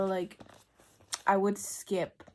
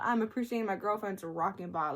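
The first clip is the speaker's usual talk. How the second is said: ordinary talk, nothing marked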